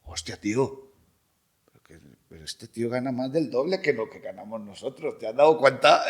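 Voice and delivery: incredulous Spain-accented impression; Spanish security guard. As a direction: play a stunned guard from Spain. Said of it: "venía el otro con el segurata".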